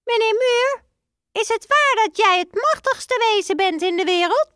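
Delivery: very high, squeaky little mouse voice; high mouse voice ending in a piep; squeaky father mouse. Acted.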